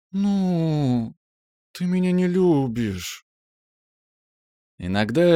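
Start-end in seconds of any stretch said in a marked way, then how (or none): 0.1-3.2 high, slow, whiny complaint; whiny spoiled pouty drawl. Acted. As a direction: pout it like a spoiled girl. Giulia keeps whining.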